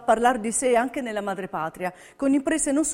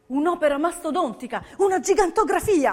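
enthusiastic; 6 semitones higher, 3 dB louder than usual. Real, not acted. Enthusing over Balbo's road.

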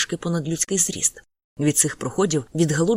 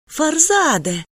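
high, loud call across the room; a mother calling her son loudly; 10 semitones higher, 4 dB louder, much slower than usual. A narrator's reading. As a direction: call his name from afar. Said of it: "крикнула жінка".

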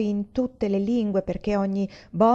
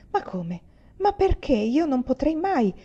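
stunned questions climbing high; dismayed; 6 semitones higher than usual. A narrator's reading. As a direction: rise into pained disbelief. Moglie sbigottita dall'accusa del marito.

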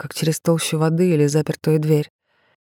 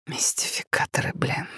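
wheezing, short of air; hoarse wheeze; 8 dB quieter than usual. A narrator's reading. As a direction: rasp it out breathlessly. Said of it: "просипел он".

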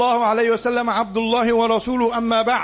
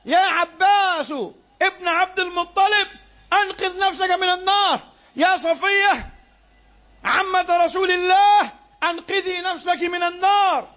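raised, higher, urgent calling out to the clans; preaching at full force; 8 semitones higher than usual. Real, not acted. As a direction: sound the alarm like a warner from the hilltop. Preacher warning about the fire. (نداء تحذير بصوت عالٍ حماسي)

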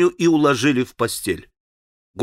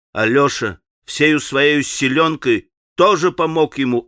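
usual speaking voice

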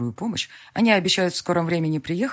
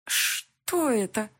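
croaking; 5 semitones higher, much slower than usual. A narrator's reading. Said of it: "Сиплю".

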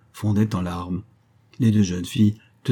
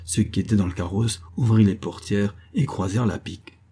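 usual speaking voice

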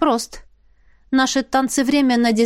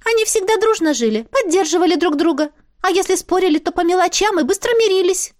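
high, squeaky little spirit, businesslike; 8 semitones higher than usual. Acted. Spirit talking business with the heroine, high squeaky voice. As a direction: pipe it up like a small sprite.

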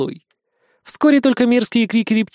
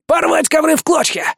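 much higher, furious exclamation; furious bat; 10 semitones higher than usual. A narrator's reading. Bat raging at the carpets, high and furious. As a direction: spit it out in rage.

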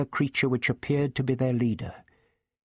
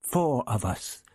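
higher, halting, pleading voice; timid stammered appeal; anxious faltering plea; 8 semitones higher than usual. A narrator's reading. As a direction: plead nervously, then halt lamely. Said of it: "Barbara halted lamely".

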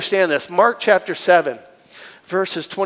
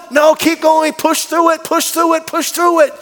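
higher, insistent urging; mock coach push; 7 semitones higher, 3 dB louder than usual. Test staff refusing to let the patient quit, higher and insistent. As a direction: keep shoving them through it. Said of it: "And you're like".

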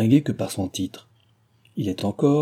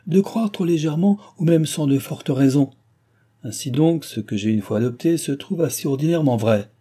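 higher, louder haughty lecture; arrogant, irritated; 6 semitones higher, 4 dB louder than usual. A narrator's reading. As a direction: press the point down, offended. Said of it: "s'écria-t-il arrogamment et très irrité".

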